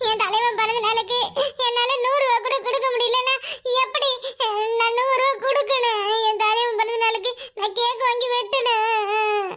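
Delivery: hammed-up sobbing wail, words drawn out; fake crying. Son faking tears for birthday cake money, wailing. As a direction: pretend to bawl to get your way. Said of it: "அழுவுற மாதிரி நடிச்சாதே எங்க அம்மா நம்புவாவ".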